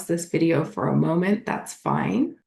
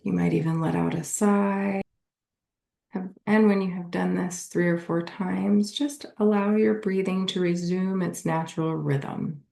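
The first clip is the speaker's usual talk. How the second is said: guided meditation; 4 semitones lower than usual. Acted. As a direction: lead them gently through a body scan, unhurried.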